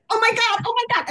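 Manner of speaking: high-pitched, excited mock scream; retold screaming. Filmmaker reliving the Sundance call, screaming in retelling. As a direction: squeal it like an overjoyed winner. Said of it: "screaming at the top".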